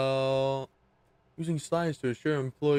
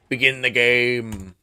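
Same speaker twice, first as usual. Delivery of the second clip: slow, loud, dramatic command; booming command; 8 dB louder, slower than usual. Pretend. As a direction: boom it out like a herald. Gamer booming at the game.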